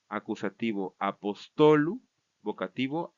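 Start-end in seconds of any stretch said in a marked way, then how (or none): 1.0-2.0 slow, higher "apostolu", stress on TO; penultimate stress. Shown as a demonstration. Teacher reciting plural accusative, slow stress on TO in "apostolu". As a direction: lift and lengthen TO in "apostolu". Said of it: "se va a cambiar a la penúltima sílaba".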